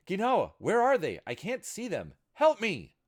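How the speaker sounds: high, loud terrified scream. A narrator's reading. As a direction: scream for help in terror. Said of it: "Hijiko screaming from behind him".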